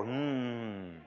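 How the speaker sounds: a closed-mouth grumpy hmm; imitating an angry hum. Son imitating his dad's mad hum, grumbling. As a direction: hum it like a dad holding in anger. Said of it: "When you're mad, you go".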